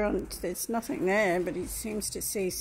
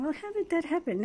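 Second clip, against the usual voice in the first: puzzled thought; 10 semitones higher, faster than usual. Retold or imitated. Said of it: "So I'm thinking".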